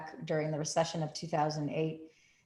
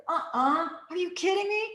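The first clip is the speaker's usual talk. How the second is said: put-on higher, incredulous girls; disbelief; 10 semitones higher than usual. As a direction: sound amazed and doubtful.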